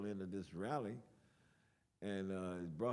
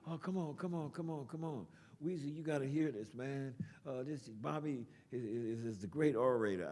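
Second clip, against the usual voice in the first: higher-pitched imitation of a pleading friend; coaxing friend; 5 semitones higher than usual. Friend begs the reluctant poet to perform, coaxing. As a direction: coax insistently, voice pushed up.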